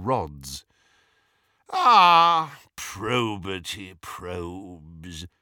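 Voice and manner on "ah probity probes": theatrical sigh. A narrator's reading. Travers on reaching the guards, theatrically sighing. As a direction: sigh it grandly, stretching every word. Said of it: "sighed Travers theatrically".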